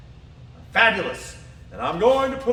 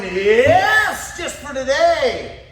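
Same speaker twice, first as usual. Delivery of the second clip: emphatic mantra; 5 semitones higher, 5 dB louder, much slower than usual. Shown as a demonstration. Self-talk mantra spoken aloud.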